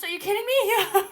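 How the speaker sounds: ordinary talk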